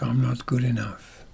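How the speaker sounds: near-whispered inner voice; quiet self-talk. Shown as a demonstration. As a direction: murmur it privately to yourself. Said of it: "when you're speaking it quietly".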